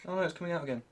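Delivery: ordinary talk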